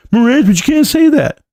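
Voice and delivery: high-pitched mimicked protest; mock objector. Streamer imitating critics mad at him over DRS, mock objection. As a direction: put on a complainer's high voice. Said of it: "I don't care who's after me, who's mad at me".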